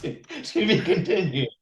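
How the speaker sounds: hammed-up cliffhanger line; dramatic sign-off. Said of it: "dramatic sign-off".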